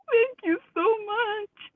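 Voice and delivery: high, slow, weepy imitation of her ex; mock tearful thanks. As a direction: whimper it out like a crying man. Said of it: "He's crying".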